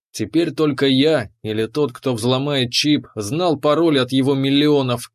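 ordinary delivery

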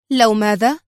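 quick, eager question. A narrator's reading. Woman asking for his condition, eagerly. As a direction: jump on the hanging condition. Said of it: "سألته في لهفة".

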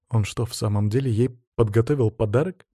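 ordinary delivery

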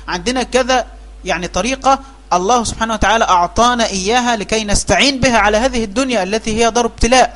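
normal talking voice

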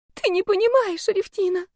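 put-on high, plaintive girlish cry; tearful protest. A narrator's reading. Girl choking back sobs to roommate, high tearful protest. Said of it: "давясь рыданиями".

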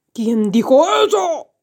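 put-on booming giant voice. A narrator's reading. Giant hunts for whoever spoke, booming demand. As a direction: demand it like a startled storybook giant. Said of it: "preguntó el gigante".

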